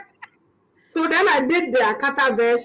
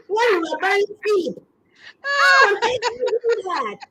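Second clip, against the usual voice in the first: mock outrage; 9 semitones higher than usual.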